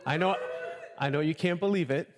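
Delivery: ordinary talk, nothing marked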